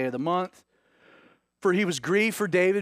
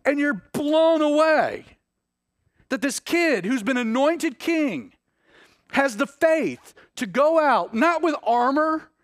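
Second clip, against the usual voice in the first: higher, louder, slowing at the peak; fervent preaching; 10 semitones higher, 5 dB louder than usual. Acted. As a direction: lift the congregation with rising wonder. Preacher builds to fervent climax.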